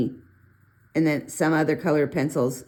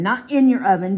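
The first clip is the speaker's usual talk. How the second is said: "in" hit harder and louder than the rest; louder "not in your oven" with weight on "in"; stress on in; 4 semitones higher, 6 dB louder than usual. Real, not acted. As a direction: lean on "in" to correct. Correcting where pencils go.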